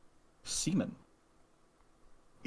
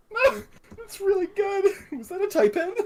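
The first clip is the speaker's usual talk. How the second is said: laughing; 5 dB louder than usual. Real, not acted. Cracking up at a card.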